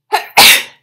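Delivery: sudden loud cough. Real, not acted. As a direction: cough into the mic.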